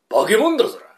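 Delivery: loud, high indignant cry; indignant outburst. Acted. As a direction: scold her with outrage. Husband scolds wife indignantly.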